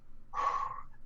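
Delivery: long, soft breath out; steadying sigh; calming exhale. Real, not acted. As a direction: breathe out to settle yourself. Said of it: "breathe, April".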